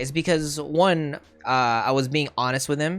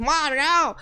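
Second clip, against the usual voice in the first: high-pitched protest to mom; super defensive; 13 semitones higher than usual. Retold or imitated. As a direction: whine back defensively, much higher. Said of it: "I'll get, like, super defensive".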